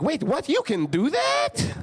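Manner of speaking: imitating dad, high and slow amazed disbelief. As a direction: stretch it out like he can't believe it. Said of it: "He's like".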